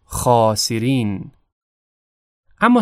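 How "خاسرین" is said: خاسرین with a full, heavy خا, slowly. Shown as a demonstration. Teacher demonstrating the heavy خا in خاسرین, slow and full. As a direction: say خاسرین slowly with a thick, backed خا.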